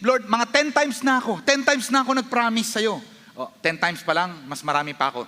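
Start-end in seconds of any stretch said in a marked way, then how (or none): 0.0-3.0 high, anguished plea to God; raised, desperate prayer; condemned pleading. Acted. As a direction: act out a sinner confessing unworthiness. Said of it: "if you're feeling condemned and you feel like".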